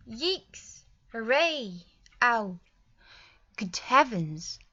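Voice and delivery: loud, punchy interjections, the last slow; strong feeling. Shown as a demonstration. Teacher demos interjections for students, loud and emphatic. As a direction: blurt each one out with feeling. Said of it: "interjections to express strong feelings".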